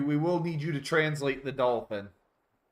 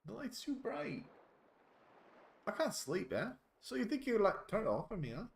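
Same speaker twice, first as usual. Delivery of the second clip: much higher, softer put-on voice; complaining dolphin character; 9 semitones higher, 7 dB quieter than usual. Dolphin grumbling about the bright light, high and soft. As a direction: whine it quietly in character.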